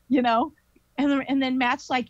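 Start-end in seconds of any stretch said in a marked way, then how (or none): none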